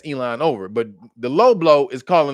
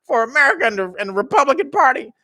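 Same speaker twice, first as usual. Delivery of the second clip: high, louder whining voice; mock panicked insiders; 9 semitones higher, 4 dB louder than usual. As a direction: fret dramatically about the split. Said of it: "they were like".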